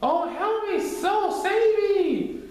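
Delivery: very high, very slow wail for help; hammed-up terrified plea; drawn-out high cry; mock panicked cry.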